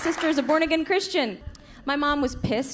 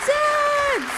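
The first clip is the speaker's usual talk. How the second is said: high, loud call to the crowd; 3 dB louder than usual. Real, not acted. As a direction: shout for the dads in the room. Calling out to audience.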